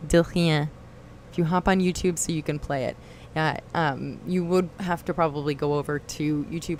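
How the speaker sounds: ordinary delivery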